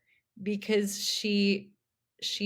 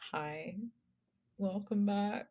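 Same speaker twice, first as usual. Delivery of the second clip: flat, much softer tired hello; low-energy greeting; 8 dB quieter than usual. Retold or imitated. Podcast host tired of cohost, subdued greeting. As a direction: mumble it like you're worn out from a week together. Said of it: "we're like".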